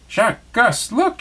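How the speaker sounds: high, bright call to her friends; excited cry. Acted. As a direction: call them over with joy. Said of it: "she cried".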